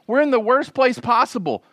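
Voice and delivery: high, panicky voice of the frightened people; freaking out. Retold or imitated. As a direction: act out the people's alarm. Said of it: "freak out like the co-pilot".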